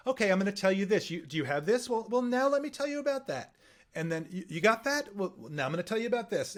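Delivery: quick, chatty storyteller lilt; storytelling cadence. Shown as a demonstration. Coach showing how to tell a story on air, lively conversational lilt. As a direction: tell it like you're talking to a friend. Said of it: "So it'd be like".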